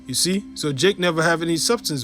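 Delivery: ordinary talk, nothing marked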